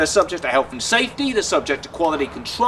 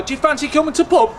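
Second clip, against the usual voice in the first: put-on higher Leeds accent, article dropped before "pub"; 6 semitones higher than usual. Leeds local inviting someone out, higher Leeds accent. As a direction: ask it in Leeds dialect, straight from "to" into "pub". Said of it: "In Leeds, the word "that" is completely alliterated".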